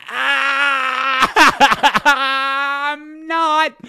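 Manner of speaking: high, loud laughing cry; laughing shriek. Real, not acted. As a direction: crack up in disbelief.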